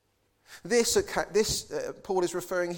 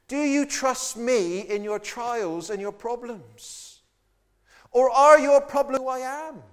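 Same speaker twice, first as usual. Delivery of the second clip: builds to a loud, higher cry; God's challenge; 4 semitones higher, 9 dB louder than usual. Acted. Preacher voicing God to his people, rising to a shout. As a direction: demand an answer, rising to a shout.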